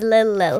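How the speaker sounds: lightly imitating a toddler saying "Lil Lil"; toddler's Lil Lil. Girl imitates the baby sister's "Lil Lil" for Lily, lightly. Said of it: "instead of Lily, she says".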